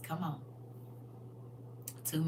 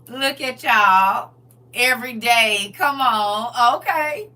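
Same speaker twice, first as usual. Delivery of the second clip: laughing; 2 semitones higher, 10 dB louder, slower than usual. Real, not acted. Laughing at congregation.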